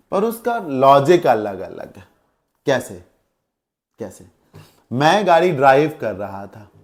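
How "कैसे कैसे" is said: ordinary talk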